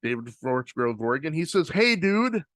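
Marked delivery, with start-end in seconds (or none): none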